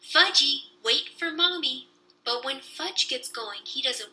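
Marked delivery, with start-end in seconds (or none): 0.0-1.9 hollering. A narrator's reading.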